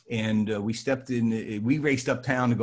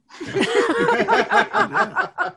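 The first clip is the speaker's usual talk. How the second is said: burst of laughter; 8 dB louder than usual. Real, not acted. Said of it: "You want me to keep talking?".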